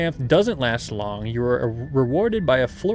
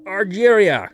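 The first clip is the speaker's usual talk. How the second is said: repeated name; 7 semitones higher, 8 dB louder, slower than usual. Acted. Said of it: "he repeats".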